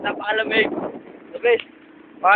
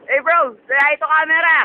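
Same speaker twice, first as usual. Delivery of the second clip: calling out; 7 semitones higher than usual. Real, not acted. Calling out to a friend.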